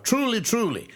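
preacher stressing "Truly, truly"; emphatic truly. Acted. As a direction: ring out "Truly, truly". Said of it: "He's putting emphasis on it again".

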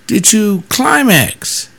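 high, slow, deadpan question; blunt pastor question. Retold or imitated. Pastor retelling his blunt question to the confessing man, slow and deadpan. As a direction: ask it dead straight, drawing it out slowly. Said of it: "I said".

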